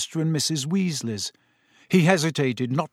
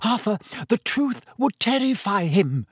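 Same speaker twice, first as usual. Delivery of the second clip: high, anxious motherly protest; shrill; 7 semitones higher than usual. A narrator's reading. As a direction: cut in, high and alarmed. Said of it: "said Missus Weasley shrilly".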